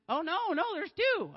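startled self-correction in front of the congregation; sudden surprise. Real, not acted. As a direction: burst out as you realise the count is wrong. Catches her own miscount mid-announcement.

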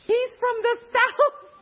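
slow, high shocked realization; shocked disbelief. Retold or imitated. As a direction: stretch it out high and stunned. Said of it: "I'm like".

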